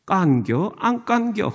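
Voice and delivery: brief, understated Chungcheong accent; Chungcheong dialect. Shown as a demonstration. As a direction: ask it simply like a Chungcheong local. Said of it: "우리 충청도에서는".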